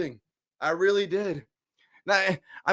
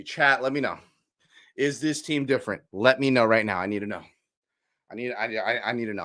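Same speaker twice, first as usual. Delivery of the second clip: ordinary delivery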